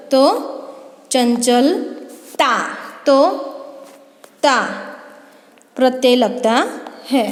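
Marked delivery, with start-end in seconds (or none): 1.1-2.5 चंचलता drawn out slowly; slow modelling. Shown as a demonstration. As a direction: stretch चंचलता for the class. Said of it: "teacher models the derived word". (चंचलता धीरे-धीरे)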